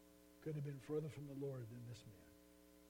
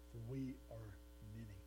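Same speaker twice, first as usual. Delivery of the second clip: lower, softer and eerie, many voices as one; a possessed man answering Jesus, lower, softer, eerie; low demon voice; 5 semitones lower, 4 dB quieter than usual. A narrator's reading. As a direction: let the demon answer from deep inside.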